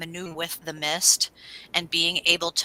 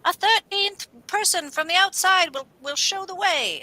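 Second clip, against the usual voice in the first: mock witch; 11 semitones higher than usual. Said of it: "the witch is like".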